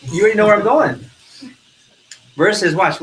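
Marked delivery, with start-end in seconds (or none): none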